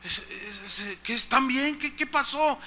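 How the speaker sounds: ordinary delivery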